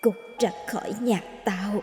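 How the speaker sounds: put-on eerie hissing ghost child, hushed and venomous; a dead baby hisses its threat at the boy; hissed. A narrator's reading. Ghost child hissing at Thành, eerie and hushed. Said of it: "Bất ngờ nó rít lên".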